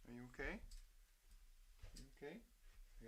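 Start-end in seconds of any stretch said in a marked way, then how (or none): none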